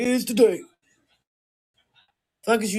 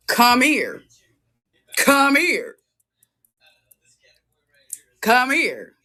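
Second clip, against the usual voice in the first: higher, harder call; louder come here; 6 semitones higher, 4 dB louder, much slower than usual. Real, not acted. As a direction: call them over firmly.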